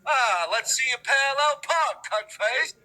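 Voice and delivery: put-on a sneering examiner taunting the kid; mock examiner. Mocking the driving examiner.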